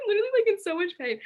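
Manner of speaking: high, fast, laughing through it; laughing pain complaint; laughing insistence. Real, not acted. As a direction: blurt it out between laughs.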